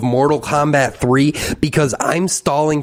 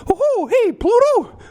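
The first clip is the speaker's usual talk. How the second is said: much higher squeaky cartoon mouse falsetto; Mickey Mouse voice; slower than usual. Mickey complaining to Pluto that Minnie left, squeaky falsetto. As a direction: squeak it out like Steamboat-era Mickey. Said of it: "I can do Mickey Mouse".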